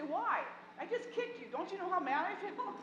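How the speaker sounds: put-on mad kinesthetic kid. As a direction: play the kid who just kicked, amused. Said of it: "they should say".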